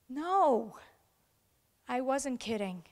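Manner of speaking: ordinary delivery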